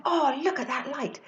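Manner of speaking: oohing like an impressed passer-by; gushing crowd. Retold or imitated. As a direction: imitate people marvelling at the sight.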